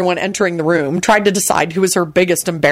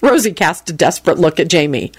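ordinary delivery, nothing marked

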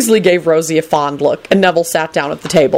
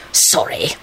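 whispered, softer hiss; 5 dB quieter than usual. A narrator's reading. Lupin grudgingly answers Kingsley, hissed apology. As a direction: hiss the word without voice. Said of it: "Lupin hissed".

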